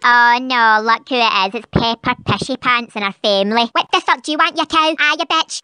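deep, rough mother; gruff low voice taunting the family; low rival mum voice. Acted.

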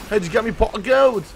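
high, loud coaxing leprechaun voice. Pretend. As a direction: lure it in like a leprechaun. Repeated leprechaun line.

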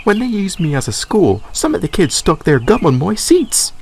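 glad to be back, bright and eager; Dexter voiced. A narrator's reading. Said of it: "said Dexter".

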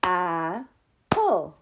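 Apple in two separate beats, AP, pause, PLE; split into syllables. Shown as a demonstration. Teacher leading preschoolers, Apple broken into two clapped syllables. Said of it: "Clap it with me again".

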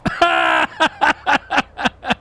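high, loud burst of staccato laughter. Acted. After joking to Herr Ralf, loud high laughter. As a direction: laugh hard in a high rattling run.

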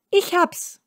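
heller, leicht aufgeregter Mädchenausruf; Ausruf. A narrator's reading. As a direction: ruf die Idee froh heraus. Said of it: "rief Schneeweißchen". (leicht aufgeregter Ausruf eines Mädchens)

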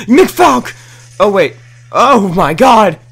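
high, loud startled cry; excited shout. Real, not acted. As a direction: yell in sudden surprise. Spots an enemy, then gasps.

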